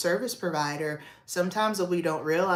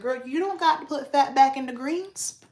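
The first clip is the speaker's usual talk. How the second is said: put-on higher, louder, emphatic girl-talk; telling her mom off about the greens, emphatically; exclaiming; 8 semitones higher, 3 dB louder than usual. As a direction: tell mom off with a playful exclamation. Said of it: "I was like".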